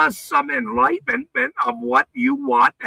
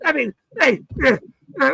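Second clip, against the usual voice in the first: ordinary talk, nothing marked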